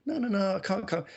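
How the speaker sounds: high-pitched, dismissive retold refusal; mock refusal. Retells turning down a friend's offer.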